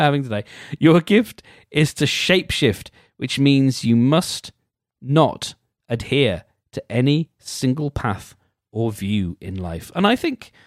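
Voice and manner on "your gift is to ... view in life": mock-earnest reading; reading aloud. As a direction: read it like a horoscope entry.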